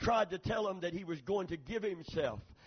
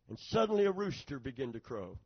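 ordinary delivery

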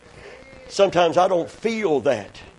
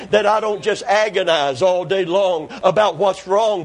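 usual speaking voice

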